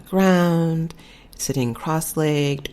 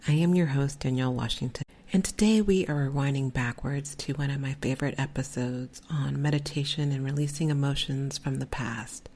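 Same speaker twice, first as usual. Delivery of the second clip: ordinary talk, nothing marked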